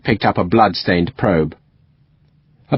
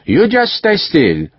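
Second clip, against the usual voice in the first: soothing; 8 semitones higher, slower than usual. A narrator's reading. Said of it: "said in French".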